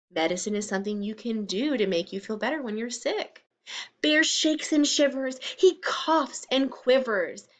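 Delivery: ordinary delivery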